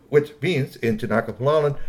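ordinary delivery, nothing marked

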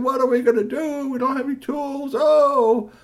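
high, loud distress; helpless wail. Acted. Stranded vendors, high loud fretting. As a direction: fret with no tools.

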